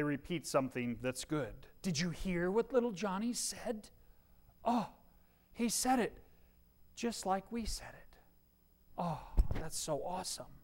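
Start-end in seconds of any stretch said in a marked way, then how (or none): 1.8-10.5 soft, awed proud-parent gush; whisper-close delight of a proud mom. Retold or imitated. Parent gushing over child's words.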